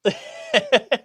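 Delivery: loud high burst of laughter. Real, not acted. Laughing at self-invitation.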